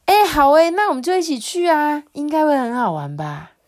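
high, delighted burst, easing down; eager agreement. A narrator's reading. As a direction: jump at the idea in a high voice.

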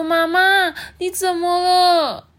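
put-on high, loud, drawn-out childish wail; 哭着说. A narrator's reading. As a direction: cry out for mama in alarm. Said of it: "象妹就哭着说". (妹妹高声哭喊妈妈)

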